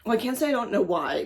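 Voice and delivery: ordinary delivery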